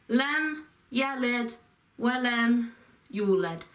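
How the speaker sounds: "لم يلد ولم يولد" spoken piece by piece with pauses; separated main sounds. Shown as a demonstration. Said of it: "Those are the four main sounds".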